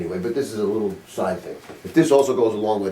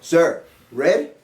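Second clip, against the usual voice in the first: higher, louder, baffled asking; baffled husband; 8 semitones higher, 4 dB louder, much slower than usual. Retold or imitated. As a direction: sound like a lost husband at the counter. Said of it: "So you're like".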